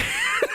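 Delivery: real high laugh; high burst of laughter. Real, not acted. Amazed at mom's roast.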